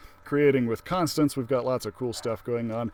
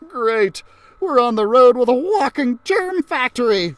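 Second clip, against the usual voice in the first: put-on high, loud, sour boy voice; sarcastic complaint; 10 semitones higher, 9 dB louder than usual. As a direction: gripe sarcastically like a nervous kid. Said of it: "Arnold said".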